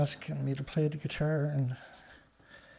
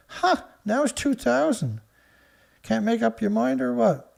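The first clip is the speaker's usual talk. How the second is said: scoffing; 5 semitones higher than usual. Real, not acted. Mocking the changing death toll.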